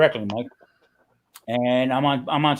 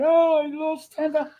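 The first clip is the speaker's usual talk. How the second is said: high-pitched imitated panic; put-on high wail of dismay; mock freak-out; 14 semitones higher than usual. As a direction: imitate a player freaking out over a loss. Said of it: "She freaked out".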